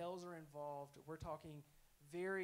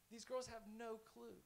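normal talking voice

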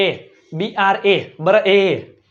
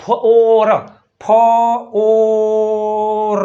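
ફોર called out loud and stretched long; loud drawn-out FOR; 5 dB louder, much slower than usual. Shown as a demonstration. Teacher drilling the sound ફોર loudly for students. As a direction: shout ફોર and hold it. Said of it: "F O R".

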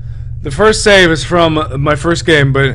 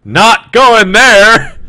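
laughing; 7 semitones higher, 5 dB louder, much slower than usual. Real, not acted. Laughs at the link joke.

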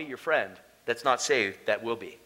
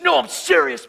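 higher-pitched retold protest; re-enacted urgent insistence; earnest insistence; 9 semitones higher, 3 dB louder than usual. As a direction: insist to a doubting buddy. Insisting to his doubting friend.

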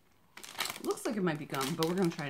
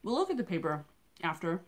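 chewing while talking; muffled by food; mouth full of candy. Real, not acted. Seen 0: Holds candy piece and wrapper, bites near 0.4, cheeks look chewing.

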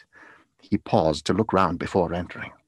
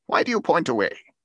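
higher, louder, urgent question; alarmed exclamation; 7 semitones higher, 5 dB louder than usual. A narrator's reading. Scrooge questioning the pointing spirit, exclaiming. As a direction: demand it with a raised voice. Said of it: "Scrooge exclaimed".

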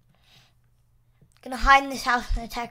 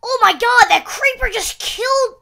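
high shocked, slowing at end; 9 semitones higher, 5 dB louder than usual. Real, not acted. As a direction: gasp at the explosion. Creeper blast shock.

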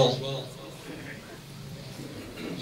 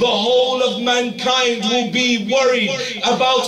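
much higher and louder, rushing line after line; impassioned preaching; 11 semitones higher, 10 dB louder than usual. Acted. Preacher translating the Imam's dua for listeners, impassioned. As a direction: cry out the translation to move the crowd.

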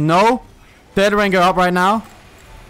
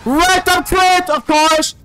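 very high, loud, slow outraged yell; angry shout; 12 semitones higher, 5 dB louder, much slower than usual. Real, not acted. Killed by critical hit.

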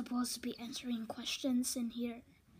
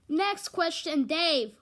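calling out; 4 semitones higher, 7 dB louder, slower than usual. Real, not acted. Calling out to Dave again.

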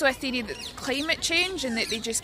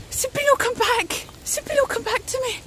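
mock plea to bird; 11 semitones higher than usual. Calling after a bird.

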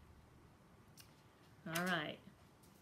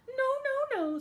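tiny high panicked voices; high squeaky refusal; 8 dB louder than usual. Acted. Wolves refusing the pig.

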